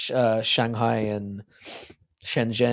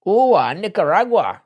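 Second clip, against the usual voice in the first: put-on high, loud, slow odd accent for Nicaragua; a sudden odd foreign accent, pitched up and slowed; weird put-on accent; 8 semitones higher, 7 dB louder than usual. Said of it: "in that accent".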